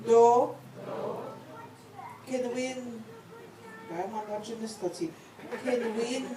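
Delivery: phrase by phrase, long pauses for repetition; said slowly. Shown as a demonstration. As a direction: lead a group recitation, lifting each phrase's end. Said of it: "I'm gonna say it slowly".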